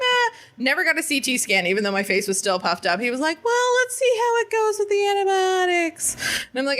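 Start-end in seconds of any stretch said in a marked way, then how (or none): 0.0-0.4 mock meh. Shrugging at doctor's visit. 3.4-6.2 high, airy dismissive doctor; lightly mocked offhand doctor; breezy doctor.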